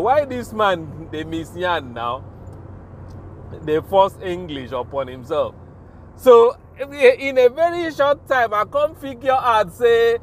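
ordinary delivery

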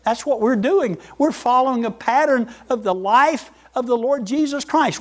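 ordinary talk, nothing marked

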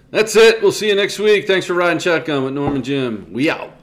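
loud, high upbeat host outro; podcast sign-off. Acted. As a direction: sign off the show with energy. Show sign-off.

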